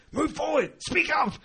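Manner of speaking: high shout; mock yell. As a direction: yell it from the side. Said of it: "I was".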